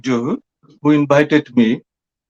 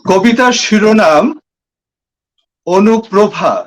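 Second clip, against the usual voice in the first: measured Bengali verse reading, raised pitch, long pauses between phrases; poetry recitation; 4 semitones higher, much faster than usual. Acted. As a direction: recite the poem phrase by phrase, projecting higher than in conversation. Recites own poem in Bangla.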